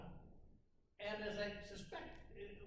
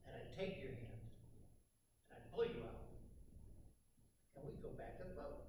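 softer, lower, tender reassurance; gentle Jesus; 4 semitones lower, 5 dB quieter than usual. Retold or imitated. Jesus reassuring Peter after the rescue, gentle and soft. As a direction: reassure him quietly like a friend. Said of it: "Jesus was probably saying later".

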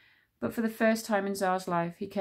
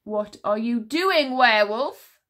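loud, slow, startled demand; shocked commander voice; alarmed question; 3 semitones higher, 8 dB louder, slower than usual. Acted. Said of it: "asked the Druid commander".

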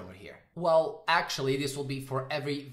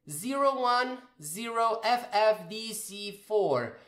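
slow readout; 4 semitones higher than usual. Shown as a demonstration. Teacher reading a memory address slowly to students. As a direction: read each digit out slowly and evenly. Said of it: "reads the address out slowly".

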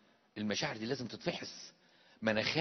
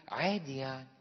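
bored shrug. Retold or imitated. Voicing indifferent believer.

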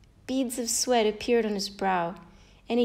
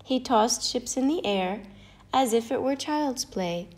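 ordinary delivery, nothing marked

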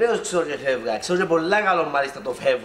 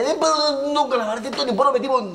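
exasperated outburst; 10 semitones higher than usual. Real, not acted. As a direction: blurt it out, fed up. Exasperated outburst about Kalamaras.

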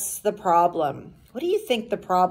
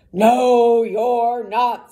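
loud troll refusal, hammed-up; 2 semitones higher, 6 dB louder, much slower than usual. A narrator's reading. Troll refusing the little goat passage, troll voice. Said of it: "said the troll".

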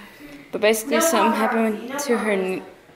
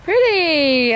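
excited; 6 semitones higher, 6 dB louder than usual. Real, not acted. Admiring the backyard view.

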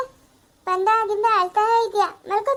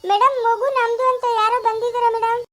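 higher, louder, hurried announcement; urgent report; 6 semitones higher, 5 dB louder than usual. Acted. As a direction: rush in with the news.

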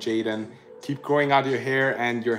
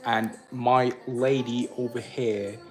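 British accent. Shown as a demonstration. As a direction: play at being British, drawing the words out. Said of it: "we're gonna do a British accent".

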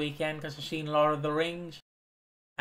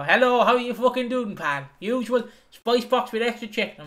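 takeaway owner's greeting; 7 semitones higher than usual. Retold or imitated. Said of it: "I walk in there to go".

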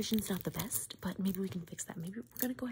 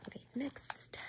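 ordinary talk, nothing marked

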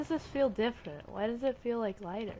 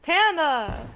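raised, higher-pitched call; 4 semitones higher, 5 dB louder than usual. Real, not acted. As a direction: call a teammate's name loudly. Calls out to another player.

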